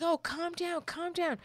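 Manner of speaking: high, soothing mock voice; mock patronising man. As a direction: imitate a guy telling her to calm down, pitched high. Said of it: "he's just like".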